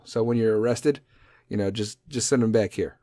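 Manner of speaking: ordinary talk, nothing marked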